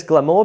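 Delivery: "glamour" as gla-MOOR; end-stressed "glamour" with a full final vowel; wrong stress on "glamour". Shown as a demonstration. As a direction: stress the second syllable of "glamour". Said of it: "screw up that syllable stress".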